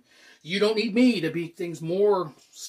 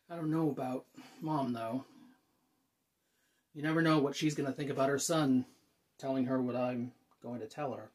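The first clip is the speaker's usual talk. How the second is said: lower, softer, uneasy; subdued worry; 4 semitones lower, 5 dB quieter than usual. Acted. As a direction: let the voice sink as doubt creeps in. Worries quietly about mother's reaction.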